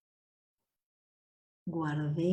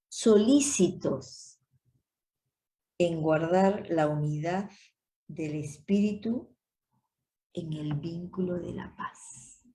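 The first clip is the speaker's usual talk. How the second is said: scripture reading; 3 dB louder than usual. Acted. Reads Efesios aloud.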